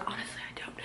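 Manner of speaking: breathy whispered aside; quick whispered confession. Real, not acted. Aside admitting she skips arms.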